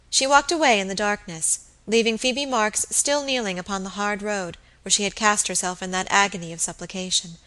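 ordinary delivery

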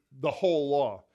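emphasize "the whole law" as the key phrase; stress on whole law. Shown as a demonstration. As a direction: press down on "whole". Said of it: "it actually emphasizes the word".

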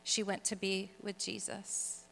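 hushed, gentle retelling of a mother's news; simply said, softly. Retold or imitated. Mom telling her daughter the sister has died, quietly and simply. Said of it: "simply said".

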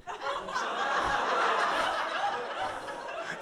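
squeaky unvoiced giggle; high breathless laugh. Real, not acted. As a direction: burst into a high airy laugh. Laugh alone.

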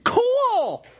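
high, excited gasp at a quarter; hammed-up wow from the pew; mock amazed onlooker. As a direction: gush like an impressed bystander. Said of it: "It's like".

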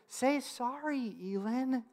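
slow, soft imitation of an earnest toddler; tender, drawn-out attempt at an apology; sincere two-year-old. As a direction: plead gently like a small child. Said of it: "with all sincerity that a two-year-old can muster".

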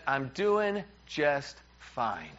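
very slow, measured, word by word; deliberately stretched, loaded reply; imitated icy wife. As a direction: imitate a wife who is clearly not fine, dragging it out. Retold wife insisting she's fine.

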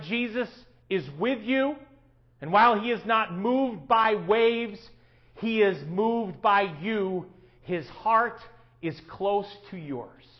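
slow, deliberate quote; slow reading. Acted. Pastor reading a quote to the congregation, slow. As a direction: let each phrase land slowly. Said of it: "Patrick and Ruth Schwenk write".

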